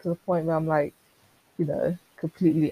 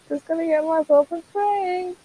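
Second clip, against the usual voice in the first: high put-on voice, much higher than usual; high silly voice; 12 semitones higher than usual. Shown as a demonstration. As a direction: repeat the line in a high, goofy voice.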